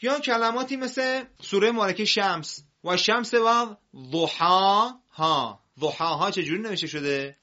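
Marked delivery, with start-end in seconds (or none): 2.8-5.6 slow Quran recitation. Shown as a demonstration.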